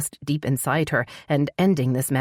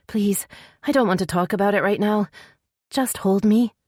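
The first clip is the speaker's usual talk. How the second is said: higher, desperate female character voice; urgent, high-pitched plea; desperate edge; 6 semitones higher than usual. A narrator's reading. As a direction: plead to be held, voice raised in pitch. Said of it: "There was a desperate edge to her voice".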